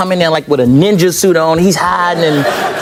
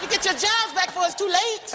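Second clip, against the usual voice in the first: mock boast; 13 semitones higher, 9 dB quieter than usual. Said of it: "I was like".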